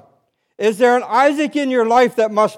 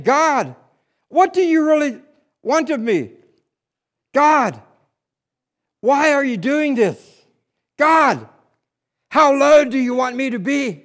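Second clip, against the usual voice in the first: retold cry to God; 5 semitones higher than usual. Husband pacing the kitchen, crying out to God in desperation. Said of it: "I'm crying out to God".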